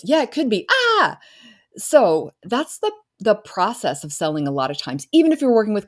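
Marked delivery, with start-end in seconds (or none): 0.7-1.2 very high, loud startled squeal; overwhelmed squeal. Retold or imitated. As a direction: squeal as if caught off guard. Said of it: "mimics client overwhelm".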